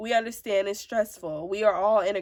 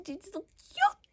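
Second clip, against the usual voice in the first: cutesy gush; 11 semitones higher than usual. Real, not acted. Gushing over her kids.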